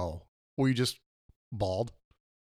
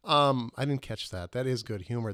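usual speaking voice

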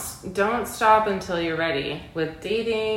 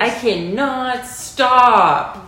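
slow; 3 semitones higher, 5 dB louder, much slower than usual. Real, not acted. Confesses she can't quit nicotine.

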